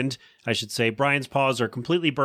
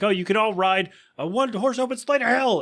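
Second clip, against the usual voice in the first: pitched up, bitter outburst; retold angry mom; mock furious; 8 semitones higher than usual. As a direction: curse them all on the way out. Lois storming out furious.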